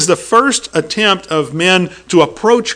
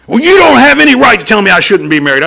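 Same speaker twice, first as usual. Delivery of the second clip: loud, higher indignant protest; indignant retort; 6 semitones higher, 6 dB louder than usual. Retold or imitated. Angry husband defending his marriage, loud indignant protest. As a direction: push back hard and offended.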